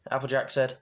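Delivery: usual speaking voice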